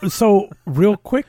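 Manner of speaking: talking through a laugh, high and loud. Real, not acted. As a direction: start slowly while still laughing. Laughs while resuming the discussion.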